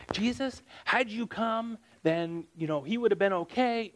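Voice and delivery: high, whiny complaining tone. Shown as a demonstration. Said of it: "we don't have a complaining voice".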